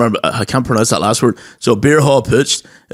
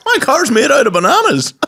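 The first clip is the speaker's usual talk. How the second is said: high mock old-lady voice; dotty old granny; 12 semitones higher than usual. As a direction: say it like a muddled granny.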